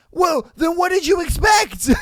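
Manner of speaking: shouting high and loud in disbelief; outraged shout. Real, not acted. Host yelling at a cheater's excuse, outraged shout. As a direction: yell it like you can't believe the excuse.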